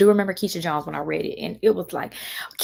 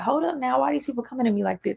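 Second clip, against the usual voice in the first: taken aback; 6 semitones higher than usual. Retold or imitated.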